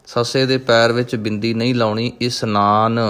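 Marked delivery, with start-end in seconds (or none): 2.2-3.0 ਇਸਨਾਨ with a plain s, not sh; correct pronunciation. Shown as a demonstration. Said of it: "ਇਸ਼ਨਾਨ ਨਹੀਂ ਉਚਾਰਨ ਕਰਨਾ". (ਇਸਨਾਨ ਬਿਨਾਂ ਬਿੰਦੀ ਦੇ)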